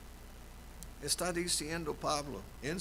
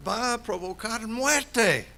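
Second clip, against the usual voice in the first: loud, higher preaching cry; full-force preaching; 10 semitones higher, 9 dB louder, slower than usual. Acted. Pastor proclaiming to the congregation, loud preaching. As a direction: preach it out to the whole church.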